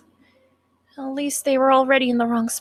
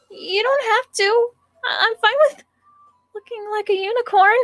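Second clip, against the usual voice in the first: put-on high, whiny cute girl; 11 semitones higher, 3 dB louder than usual. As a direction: pout playfully like a sweet schoolgirl. Sayori's voice.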